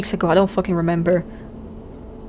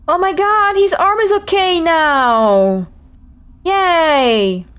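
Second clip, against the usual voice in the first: high, slow, thrilled squeal; delighted; 7 semitones higher, much slower than usual. Real, not acted. Delighted reaction to arm healed.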